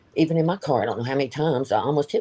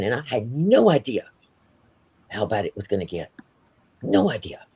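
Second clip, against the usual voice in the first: usual speaking voice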